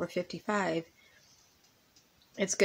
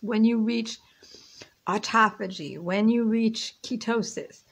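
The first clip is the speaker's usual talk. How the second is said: ordinary talk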